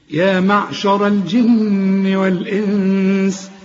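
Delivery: slow tajweed chant, humming the n in "الجن" and "والإنس"; recitation with ghunnah. Shown as a demonstration. Teacher reciting a verse for students, hummed recitation. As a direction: hold each n long. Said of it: "هنا برضو في غنة".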